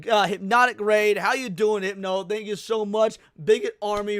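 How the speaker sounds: normal talking voice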